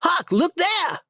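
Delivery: put-on high excited boyish call; shouted. A narrator's reading. Tom finds the box and shouts to Huck, high excited call. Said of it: "Tom went around a corner and shouted".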